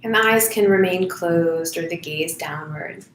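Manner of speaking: soft, unhurried meditation voice with long pauses; guided meditation. Acted. Volunteer leads online listeners into noticing breath and body, guided meditation. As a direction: guide them gently inward, phrase by phrase, leaving silences.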